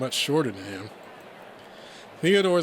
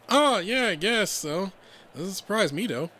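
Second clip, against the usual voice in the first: casual friend; 8 semitones higher, 4 dB louder than usual. Acted. Casual reply in acted dialogue.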